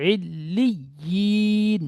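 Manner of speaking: ইল্লিয়িন chanted slowly, with the double l held and no long vowel; slow chanted tajweed model; Quran recitation. Shown as a demonstration. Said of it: "লামটি ইয়ার সাথে যুক্ত হবে".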